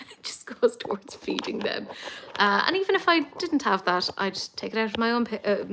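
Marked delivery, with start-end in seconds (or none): 0.1-1.9 laughing. Real, not acted. Laughing aside.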